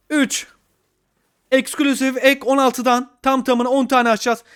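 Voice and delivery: ordinary talk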